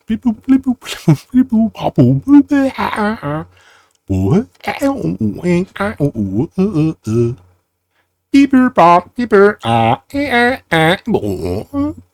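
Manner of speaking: high robot bleep bloops. Pretend. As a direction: chirp like a little robot. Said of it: "that's just standard robot talk".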